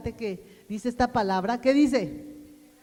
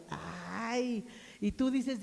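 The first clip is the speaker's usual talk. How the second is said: usual speaking voice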